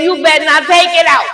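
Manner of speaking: high, loud retold order; loud, high-pitched mock scolding; acted stern warning. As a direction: re-enact a mother hollering a warning. Mother warning daughter, retold.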